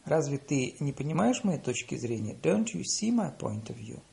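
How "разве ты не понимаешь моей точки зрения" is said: ordinary delivery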